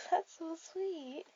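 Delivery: giggle, then a slow touched reply; laughing. Acted. Girl laughs at her date's sweet answer, charmed. Date says he likes knowing her.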